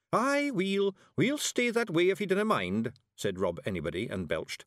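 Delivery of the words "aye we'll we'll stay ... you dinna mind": high Scottish little-man voice; feisty Scots warrior. A narrator's reading. Tiny warrior answers witch, feisty Scots voice.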